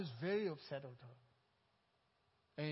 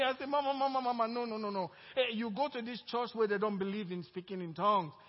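loud, high, fervent preaching; preaching at full force; 5 semitones higher, 11 dB louder than usual. Real, not acted. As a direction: preach it out to the congregation. Preaching climax of testimony.

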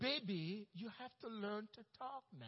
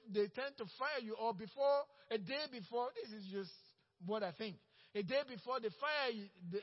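ordinary talk

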